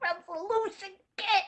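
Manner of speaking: hushed, amazed re-enactment; soft, disbelieving confiding; retold stunned news. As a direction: break big news to a friend very softly. Retold phone call of amazed news.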